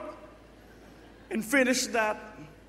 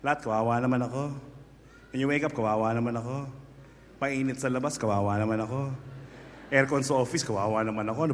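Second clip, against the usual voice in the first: low, soft, sorry-for-myself moan; self-pitying whine; 6 semitones lower, 5 dB quieter, faster than usual. Pretend. Preacher mimics a self-pitying listener, low and soft. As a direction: moan like nothing ever goes right. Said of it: "this is what you feel".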